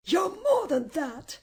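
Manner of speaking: high-pitched, wounded accusation; grieved. A narrator's reading. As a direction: protest with a hurt tone. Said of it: "in a grieved tone".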